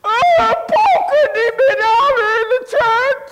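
hammed-up tearful wail; mock crying. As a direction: imitate a second man sobbing out the words. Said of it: "And he starts crying".

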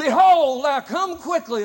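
high, loud preaching shout; full preacher's shout. Retold or imitated. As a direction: belt it from the pulpit. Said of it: "this time he really gets in there".

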